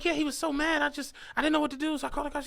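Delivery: mock victim explaining herself; imitated flustered woman.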